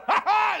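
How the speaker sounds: high, startling "ha ha"; sudden mock laugh. Shopper in the grocery store startling people, sudden high laugh. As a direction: blurt out a staged laugh. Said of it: "You go".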